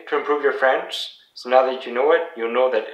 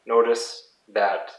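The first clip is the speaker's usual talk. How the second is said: ordinary talk, nothing marked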